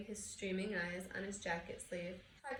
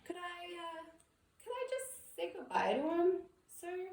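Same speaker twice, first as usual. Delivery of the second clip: higher, halting request of a big-hearted giant; tearful giant's plea; 9 semitones higher, 3 dB louder than usual. Acted. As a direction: ask timidly, close to tears. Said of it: "asked Hagrid".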